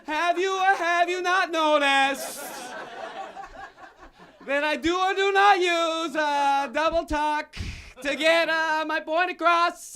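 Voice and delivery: high, loud, strained yelling; furious dad rant. Acted. Father yelling at his son about double talk. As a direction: scream it like a father losing it. Enraged dad ranting at kid.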